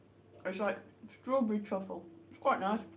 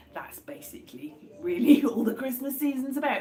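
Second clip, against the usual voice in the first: whispered laughing aside; 4 semitones higher, 5 dB louder than usual. Real, not acted. Joking aside about holiday drinking.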